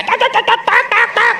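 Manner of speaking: high rapid tak tak tak; mock nagging chatter. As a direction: rattle it off like a nagging tongue. Imitating wife's nagging.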